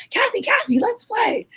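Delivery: imitating a tiny pre-K sister tugging at her; little sister voice. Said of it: "She's like".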